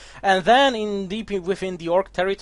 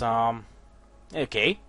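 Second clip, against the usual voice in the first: ordinary delivery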